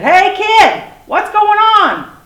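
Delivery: high, loud, hailing character voice; flying dinosaur. A narrator's reading. Flying dinosaur hails kid from treetops, high loud call. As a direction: squawk a big friendly call from above.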